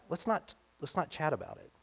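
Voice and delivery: imitating a hushed, furtive objector; mock-secretive, as if afraid of being overheard; secretive objection. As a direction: drop to a very soft confidential voice. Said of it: "like maybe there was a secret".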